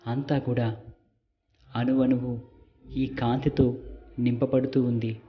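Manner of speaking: calm meditation guide with long pauses; guided meditation. Real, not acted. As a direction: lead them gently, phrase by phrase, leaving silences. Leads a light meditation. (నెమ్మదిగా, విరామాలతో ధ్యాన మార్గదర్శక స్వరం)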